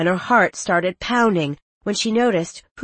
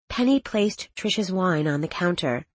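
ordinary delivery, nothing marked

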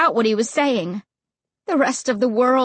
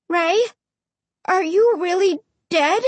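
frightened calling out; 7 semitones higher than usual. A narrator's reading. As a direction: call out to him in fear. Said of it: "I managed to call out".

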